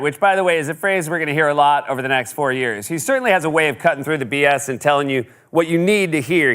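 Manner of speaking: ordinary talk, nothing marked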